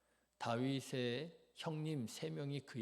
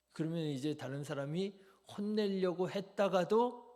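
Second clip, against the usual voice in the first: ordinary talk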